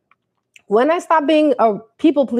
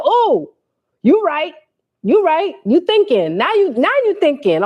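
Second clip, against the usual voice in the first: mock relieved agreement; 5 semitones higher than usual.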